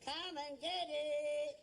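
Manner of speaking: long drawn-out trader's call; slow resonant holler; street cry on request. Shown as a demonstration. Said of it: "Say that again".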